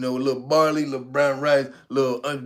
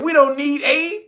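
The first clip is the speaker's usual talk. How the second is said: high, slower proud retort; mock president, proud; 11 semitones higher than usual. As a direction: stretch the "don't", pitched up. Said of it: "He said".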